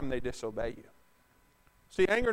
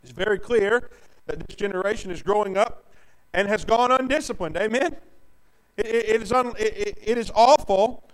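louder, driving pulpit cadence; preaching; 4 semitones higher, 7 dB louder than usual. Acted. Preacher warning the congregation about undisciplined children, fervent. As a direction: hammer each place home.

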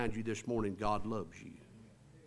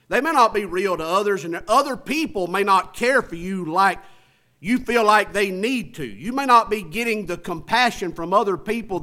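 louder, higher preaching at full force; 7 semitones higher, 11 dB louder than usual. Acted. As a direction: preach it out to the whole church.

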